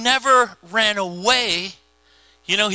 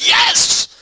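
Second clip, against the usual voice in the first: loud, high, triumphant yell; acted cheer of huge relief; celebrating; 6 dB louder than usual. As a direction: explode with joy at being finished. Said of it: "I'd be like".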